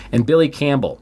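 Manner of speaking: ordinary talk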